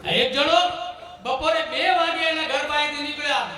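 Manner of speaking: ordinary talk, nothing marked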